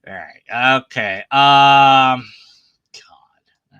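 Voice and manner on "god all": breathy whispered God, under the breath; whispered exasperation. Real, not acted. Fed-up atheist whispering to himself over the broken argument. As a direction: barely voice it in despair.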